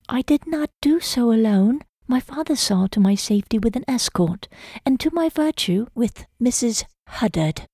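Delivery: put-on a young lady bristling at being scolded; frustrated young woman. A narrator's reading. Hannah defends her coming to her suitor, mildly frustrated. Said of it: "There was a hint of something else in her voice. Frustration?".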